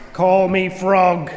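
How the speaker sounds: slow, commanding frog voice; lightly voiced imposing frog. A narrator's reading. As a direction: announce yourself like a lordly frog. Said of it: "said the frog in an imposing voice".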